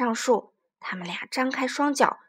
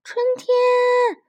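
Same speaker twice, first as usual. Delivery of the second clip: high, loud call into the woods; calling loudly; 11 semitones higher, 5 dB louder than usual. Acted. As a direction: shout out for spring, much higher. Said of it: "马库大声喊".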